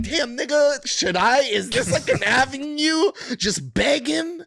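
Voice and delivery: mock the beggars in disbelief. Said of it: "I'm like".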